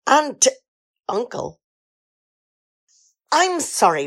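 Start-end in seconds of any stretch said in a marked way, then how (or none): 0.1-1.6 stumbling over the written "t-" in a halting, lightly northern read; forced "t" dialect. Acted. Reading the writer's dialect lines to Jonathan, halting and forced. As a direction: reluctantly read awkward dialect lines, stuttering on each "t".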